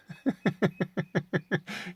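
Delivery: high giggling laugh; high, rippling laugh; amused laugh. Real, not acted. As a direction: laugh in a run of high chuckles. Laughs at his own small earnings.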